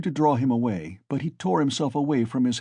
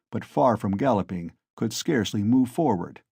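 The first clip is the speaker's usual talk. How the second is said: ordinary delivery, nothing marked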